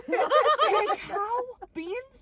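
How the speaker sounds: slow, high, laughing repeat of the word; laughing disbelief. Real, not acted. Host mocking her friend's word, laughing in disbelief. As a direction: stretch it out in amused outrage.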